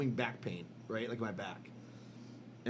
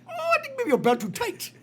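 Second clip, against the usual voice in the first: put-on much higher Indian accent repeat, breaking into laughter; Indian accent, laughing; 6 dB louder than usual. Doctor repeats his verdict in a hammed-up Indian accent. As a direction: ham up the Indian accent while laughing through it. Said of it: "Your impression of Indian guys".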